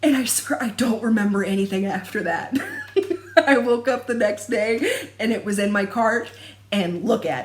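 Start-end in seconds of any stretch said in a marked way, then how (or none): 2.6-3.4 squealing laugh; high burst of laughter. Real, not acted. Recalling waking to it in her cart, high laugh. Laughing at own impulse buy.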